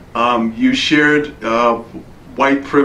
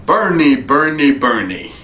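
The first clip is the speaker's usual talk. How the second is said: bad Cary Grant accent; 4 semitones higher than usual. Greeting the rector in a bad Cary Grant accent. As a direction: call the name three times in a mock Cary Grant accent, letting it slip by the end. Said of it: "That's a bad Cary Grant accent".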